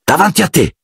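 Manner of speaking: high urgent cry; shout. A narrator's reading. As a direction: point it out at the top of the voice. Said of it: "gridò Harry".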